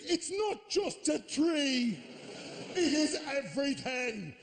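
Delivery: high, slow indignant protest; impassioned outrage. Acted. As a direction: defend it with drawn-out, high-pitched outrage. Acted joiner scolding dog owner.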